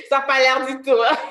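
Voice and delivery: loud protest through real laughter; laughing disbelief. Real, not acted. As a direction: laugh while refusing to believe it. (incrédule, en riant)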